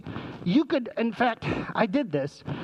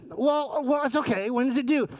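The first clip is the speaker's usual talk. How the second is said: higher-pitched imitation of a coping parent; parent holding calm; 6 semitones higher than usual. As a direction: reassure the child in a raised pitch. Parent reacting to late project news.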